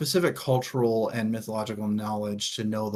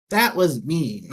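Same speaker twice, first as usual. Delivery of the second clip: mock sulky Monkey; 5 semitones higher, 5 dB louder than usual. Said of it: "basically just being like".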